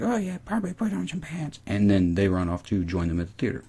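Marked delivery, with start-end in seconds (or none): 0.0-1.6 soft, high-pitched mock girl voice; Brooke. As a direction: imitate her in a light falsetto. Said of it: "Brooke's like".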